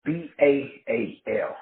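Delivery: B A A L spelled letter by letter, slow; spelled out slowly. Shown as a demonstration. Spelling out the word for his listeners, slow and deliberate. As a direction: spell B A A L out one letter at a time.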